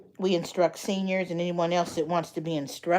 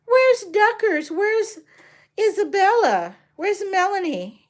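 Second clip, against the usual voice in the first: mock calling out; 13 semitones higher, 7 dB louder, slower than usual. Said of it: "I'm like".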